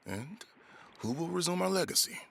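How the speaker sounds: ordinary talk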